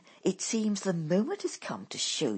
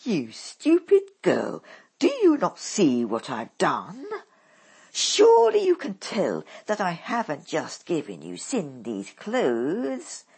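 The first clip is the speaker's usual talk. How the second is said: harsh commanding mother; 4 semitones higher, 9 dB louder than usual. A narrator's reading.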